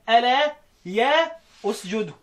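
"ألا يا اسجدوا" said slowly, each part set apart; teaching pause demo. Shown as a demonstration. Teacher demonstrating the teaching stop to his students. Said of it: "أقف تعليميا أقول إيه".

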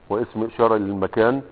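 ordinary talk

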